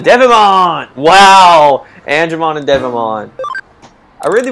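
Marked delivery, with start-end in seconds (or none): 0.0-1.0 loud, high name call; excited shout. Real, not acted. Gamer announcing a new digimon, excited. 1.0-1.9 loud amazed wow; excited wow. Real, not acted. Gamer impressed by the new digimon, loud wow.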